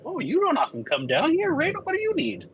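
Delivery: suara pelayan bar yang tinggi dan ramah; high bartender voice. Acted. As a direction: sapa pelanggan dengan nada tinggi. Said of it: "she'll say".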